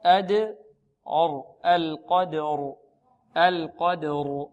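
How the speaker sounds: القدر very slow, qaf and ra heavy. Shown as a demonstration. Teacher drills القدر slowly, heavy qaf and ra. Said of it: "والراء مفخمة".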